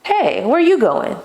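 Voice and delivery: high, light curious call; curious flirt. Shown as a demonstration. Calling out to a neighbor, curious and flirty. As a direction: open it up with a playful question. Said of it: "That's now a question".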